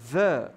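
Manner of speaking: THE set apart and loud, with uh; THE as "thuh". Shown as a demonstration. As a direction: punch THE as "thuh". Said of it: "começa com som de consoante".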